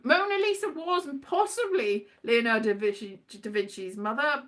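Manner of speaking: usual speaking voice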